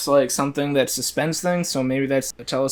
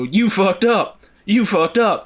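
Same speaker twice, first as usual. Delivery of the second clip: higher, louder alarm-like yell; mock alarm yelling; 7 semitones higher, 4 dB louder, slower than usual. Imagined warning system yelling at the user. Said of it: "I would have the warning go".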